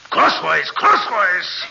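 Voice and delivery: put-on high, loud French-accented shout; angry correction. Madman correcting his captive's sawing, angry shout. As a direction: yell the instruction impatiently.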